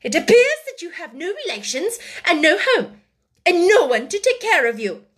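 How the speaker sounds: high, loud, scornful scolding; temper rising. A narrator's reading. Headmistress reminds the orphan she is alone, temper rising. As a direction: press the point with growing anger. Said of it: "her temper rising".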